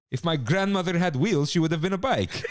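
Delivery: put-on a light Italian accent, quoting the chef; lightly imitated Italian accent, amused. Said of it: "And then his Italian accent".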